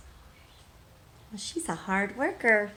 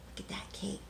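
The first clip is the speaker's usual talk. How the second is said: whisper; 15 dB quieter, faster than usual. Real, not acted. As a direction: hush it like a secret about the cake. Whispered aside to the child.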